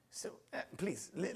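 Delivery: usual speaking voice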